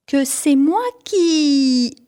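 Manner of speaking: put-on teacher outraged at the boy's excuse, slow indignant cry. A narrator's reading. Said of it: "a crié la maîtresse".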